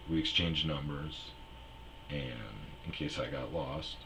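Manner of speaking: normal talking voice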